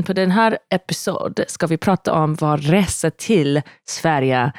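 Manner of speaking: put-on Stockholm Swedish welcome; Stockholm accent. Wife welcomes listeners in her best Stockholm Swedish. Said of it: "doing my best Stockholm accent".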